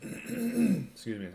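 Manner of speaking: ordinary delivery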